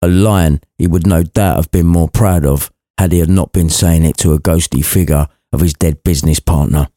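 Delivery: usual speaking voice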